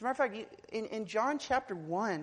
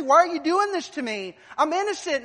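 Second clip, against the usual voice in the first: desperate plea; 8 semitones higher, 12 dB louder than usual. Pretend. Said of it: "You ask your family".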